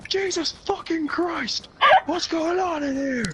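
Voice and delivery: high, slow mock outrage; exasperated yell. Bursting in on friends indoors, high drawn-out exasperated yell. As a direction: holler it, dragging out each word.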